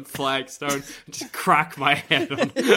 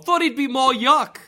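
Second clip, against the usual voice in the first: aussie tradesman; 8 semitones higher than usual. Acted. Teacher to Dumbledore.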